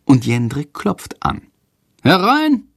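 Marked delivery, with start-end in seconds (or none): 2.0-2.6 headmaster's call. A narrator's reading. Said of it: "Direktor Lersikos Stimme".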